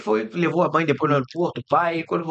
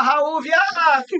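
mock yell; 10 semitones higher, much slower than usual. Fans yelling at Raul in street.

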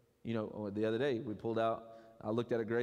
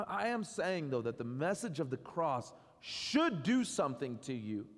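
ordinary talk, nothing marked

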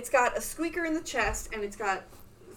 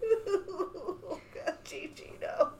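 tearful whimper; 7 semitones higher, 7 dB quieter, much slower than usual. Retold or imitated. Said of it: "And I was just like".

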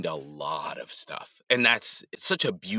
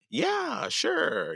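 high, lilting voice dropping on the second word; lightly put-on high-pitched agreement; retold reply, pitched way up at first; breezy acted reply. Acts own eager reply to agency.

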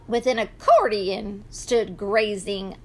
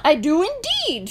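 high pig voice with a snort; snort, then answer eagerly; high piggy reply; roly pig; 7 semitones higher than usual. A narrator's reading. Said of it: "said Roly Pig".

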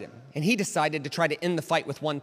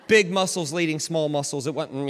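normal talking voice

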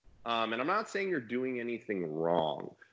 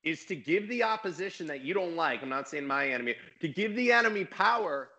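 elevated tone; 7 semitones higher than usual. Retold or imitated. Retelling his online argument with people, elevated heated tone. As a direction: pitch climbing higher, heated but not shouting. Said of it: "I'm getting elevated in my tone".

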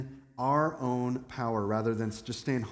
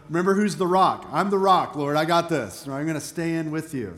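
cocky, pushed loud, then easing off; arrogant boast; 5 dB louder than usual. Acted. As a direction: brag like the favourite disciple. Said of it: "Think of the arrogance".

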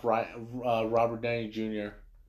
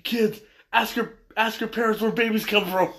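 yelling; 10 semitones higher, 5 dB louder than usual. Retold or imitated. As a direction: shout it like a cartoon hero. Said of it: "Robin jumps on the camera and yells".

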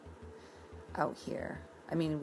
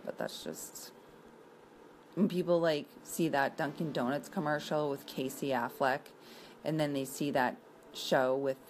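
normal talking voice

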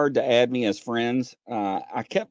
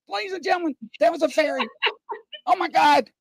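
much higher, shouted disbelief; excited shout; faster than usual. Retold or imitated. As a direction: insist to the audience, wide-eyed. Retelling seeing a fairy.

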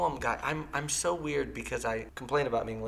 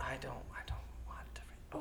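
reluctant whisper; 12 dB quieter than usual. Real, not acted. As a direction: whisper it, unwilling. Reluctant before reading poem.

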